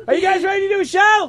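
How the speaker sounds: high, fast rallying shout to the crowd; hype shout. Real, not acted. Host revving up the studio audience, shouting. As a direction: fire up the crowd.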